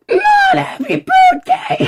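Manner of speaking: very high laughing shriek; panicked shriek. Acted. Dog dreading birthday song, very high laughing shriek. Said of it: "losing his shit".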